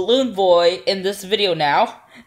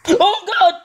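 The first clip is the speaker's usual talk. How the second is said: startled high cry; frightened outburst after a jump scare; scared horror game streamer; 11 semitones higher than usual. Real, not acted.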